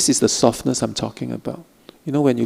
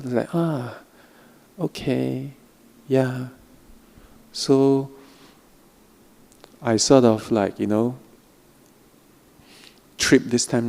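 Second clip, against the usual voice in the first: slow, soft guiding voice with long pauses; guided meditation; 4 dB quieter than usual. Acted. Teacher guiding students through a practice, soft meditation voice. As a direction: ease the listeners into softness, gently.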